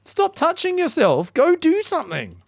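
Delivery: high, slow, whiny scolding voice; high mock scold to a fidgety player; mock exasperated scolding. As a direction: nag him in a squeaky put-on voice. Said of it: "I'm like".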